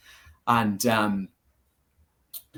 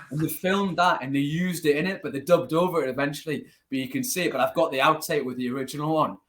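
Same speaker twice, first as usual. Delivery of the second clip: ordinary delivery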